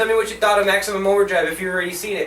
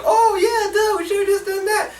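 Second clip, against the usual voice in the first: very high, silly chorus voice; mock collective realisation; 12 semitones higher than usual. Characters all agreeing on the plan, high mocking voice. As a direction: squeal it like a dim group catching on.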